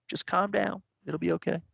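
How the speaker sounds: soft, calming self-talk; soothing. Retold or imitated. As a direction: talk yourself down gently. Soothing himself during night panic.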